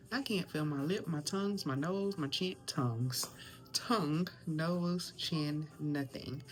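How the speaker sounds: mushy words through numb lips. Real, not acted. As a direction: speak like your mouth is numbed. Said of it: "I have numbing cream on my lips".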